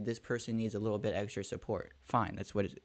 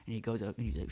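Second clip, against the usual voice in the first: usual speaking voice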